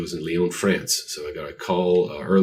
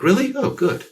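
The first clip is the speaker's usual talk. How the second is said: much higher, bright mock relief; relieved surprise; 4 dB louder than usual. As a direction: play up a relieved surprise, pitched high. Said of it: "I'm like".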